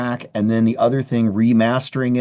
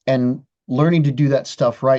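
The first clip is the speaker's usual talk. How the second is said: usual speaking voice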